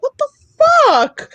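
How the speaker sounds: high, long drawn-out cry; outraged. Retold or imitated. Daughter refused a drink by her dad, outraged. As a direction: stretch it into a high mock-outraged wail.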